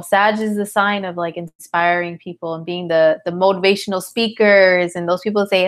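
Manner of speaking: ordinary delivery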